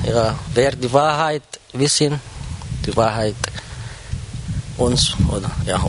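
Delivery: ordinary talk, nothing marked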